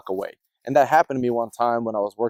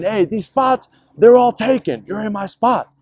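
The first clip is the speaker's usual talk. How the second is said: higher, mock indignant complaint; annoyed neighbor; 7 semitones higher, slower than usual. Benz owner scolding the cable guy over his spot, mock indignant. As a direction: act out a territorial grump.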